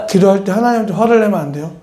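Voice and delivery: repeats the rebuke through laughter; laughing. Real, not acted. Preacher laughing at his own story, laughing. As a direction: chuckle while quoting it.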